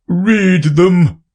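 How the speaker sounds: booming king; pompous king, loud and grand. Acted. King orders.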